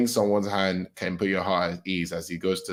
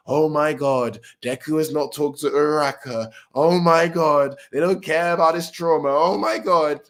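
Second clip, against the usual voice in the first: high, dragged-out fan hysteria; mock outrage; 6 semitones higher, 3 dB louder than usual. Fan community fretting that Deku hasn't talked to Uraraka, mock outrage. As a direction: imitate overwrought fans complaining. Mocking the fandom's overreaction.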